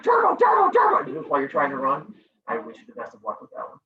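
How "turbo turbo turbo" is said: loud, high, repeated mock yell; hammed-up gamer hammering the button; frantic shouting. Teacher mimics a gamer trying to run, frantic shout.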